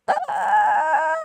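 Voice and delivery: high startled yelp; shocked gasp. Retold or imitated. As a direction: yelp like a caught-off-guard sitcom dad. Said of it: "And I was like".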